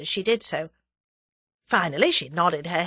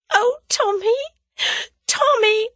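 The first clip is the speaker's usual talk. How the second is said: higher, louder tearful cry of a name; 6 dB louder than usual. A narrator's reading. Tuppence crying over Tommy's photograph, tearful. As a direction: sob his name out. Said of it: "she cried / burst into a fit of sobbing".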